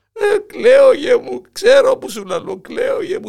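loud mock wailing. Grandpa wails loudly.

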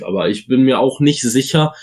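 ordinary talk

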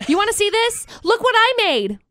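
high, eager show-and-tell voice; lightly voiced excited kid. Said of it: "It's like".

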